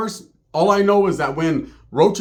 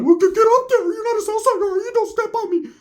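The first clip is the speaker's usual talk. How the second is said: very high squeaky put-on voice; mock scolding. Ricky telling someone to get off, high mock scolding. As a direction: scold in a cartoonish falsetto.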